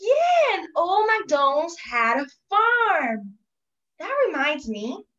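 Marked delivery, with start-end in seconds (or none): 0.0-3.3 high, loud burst, then drawn out; loud, slow, excited announcement; excited recognition. Acted. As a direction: exclaim it with a big, high attack. Excited recognition of Old MacDonald.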